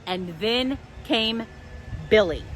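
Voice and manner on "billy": ordinary delivery, nothing marked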